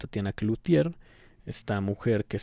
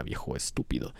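low, unvoiced under-the-breath insult; muttered aside; 10 semitones lower, 6 dB quieter than usual. Real, not acted. Muttered insult at the complainer, under the breath. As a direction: whisper the insult to yourself.

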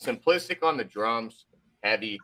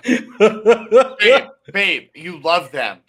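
laughing; 6 semitones higher, 11 dB louder, much slower than usual. Pretend. Teasing plea to partner, laughing.

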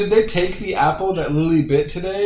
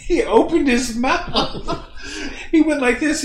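laughing; 12 semitones higher, slower than usual. Real, not acted. Laughing while retelling friend's face.

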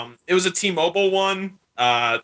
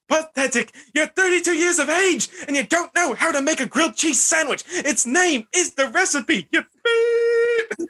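Gordon Ramsay impression; 12 semitones higher than usual. Quoting Gordon Ramsay insulting Alexa, hammed-up angry chef. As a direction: scold like a furious celebrity chef, hammed up. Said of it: "Gordon Ramsay".

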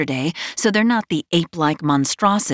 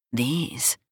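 low teasing voice; 5 semitones lower, 7 dB quieter than usual. A narrator's reading. Male character low, teasing.

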